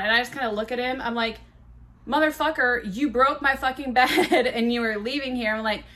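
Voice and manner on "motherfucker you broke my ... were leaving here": raised-pitch indignant complaint with laughter creeping in; mock outrage. Woman to departing man who broke her bed, mock outrage. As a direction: scold him, half amused.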